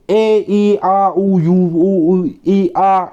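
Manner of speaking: clear, drawn-out vowel sounds ए, ई, आ, उ sung out one by one; vowel sounds demo. Shown as a demonstration. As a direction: show each vowel sound loud and open. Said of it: "यानी कि ऐसी आवाज़ आ". (स्वर ध्वनियों का स्पष्ट प्रदर्शन)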